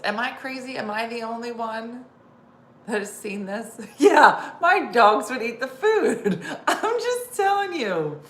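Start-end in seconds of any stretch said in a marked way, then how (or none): 4.0-8.2 real laughter, words breaking up with giggles; amused laughing aside. Real, not acted. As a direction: laugh through it, high and loud. Laughing at dog video.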